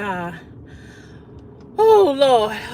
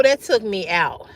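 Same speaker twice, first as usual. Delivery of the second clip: ordinary talk, nothing marked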